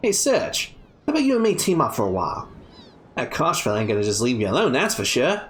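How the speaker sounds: put-on light Australian accent, brash young thief; a scrappy Aussie girl, a hint of swagger. Kid voiced with Australian accent.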